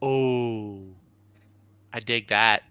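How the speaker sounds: normal talking voice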